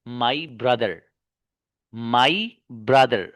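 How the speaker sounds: my brother said very slowly, word by word; slow model phrase. Shown as a demonstration. Teacher modelling the phrase for students, very slow. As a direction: lay out my brother slowly for the class. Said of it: "माई के बाद कोई ना कोई noun आता है".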